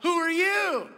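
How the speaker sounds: high, loud yell thrown back; imitating an echoed shout. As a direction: holler it like the echo answering. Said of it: "of course, he heard back".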